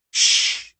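sharp unvoiced "shh"; hushing shh. A narrator's reading. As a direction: shush them to silence. Hushing someone in the story.

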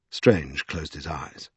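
plain reading voice

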